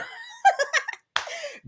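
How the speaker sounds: high-pitched burst of laughter; helpless high giggling. Real, not acted. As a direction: crack up at your own joke. Laughing at own dog joke.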